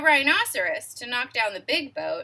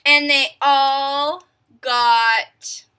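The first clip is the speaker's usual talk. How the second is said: drawn out and louder, stretching to the payoff; slow build; 10 dB louder, much slower than usual. A narrator's reading. Reader builds suspense for the children, slow and loud.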